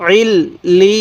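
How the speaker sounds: "আইল লি" slow, l doubled and ee drawn out into a y; doubled letters. Shown as a demonstration. Teacher showing learners letters pronounced twice, slow drawn-out joining. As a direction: lean on the double l in "আইল লি", stretching the vowel. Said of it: "এরকমভাবে পড়ব".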